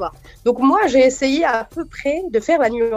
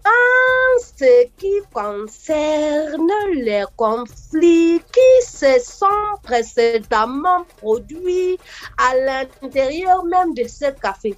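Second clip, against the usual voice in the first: put-on very slow, drawn-out Cameroonian accent, higher; 3 semitones higher, much slower than usual. Comedian imitating a long-winded Cameroonian speaker, slow and drawn. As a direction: stretch every word like a pompous Cameroonian. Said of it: "ils font de très longues phrases".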